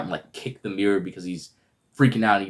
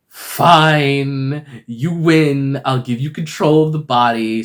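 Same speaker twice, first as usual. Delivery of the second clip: loud, higher drawn-out concession; mock-defeated, raised voice; acted grudging surrender; 6 semitones higher, 7 dB louder, much slower than usual. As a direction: give in with exaggerated exasperation. Acted reluctant surrender to Mark.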